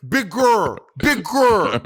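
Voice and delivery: loud, high, swooping bellow; exaggerated imitation of a shouted note; mock coaching yell. Mimicking least favourite judge's note.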